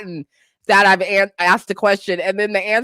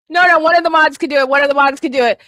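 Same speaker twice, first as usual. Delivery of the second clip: louder, higher rushed instruction; excited urgent correction; urgent reassurance; 5 semitones higher, 6 dB louder, much faster than usual. Real, not acted. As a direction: hurry to tell them.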